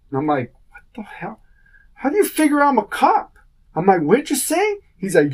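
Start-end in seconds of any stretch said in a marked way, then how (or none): 0.7-3.4 retold disbelief. Retold indignant reply. 4.0-4.8 retold challenge.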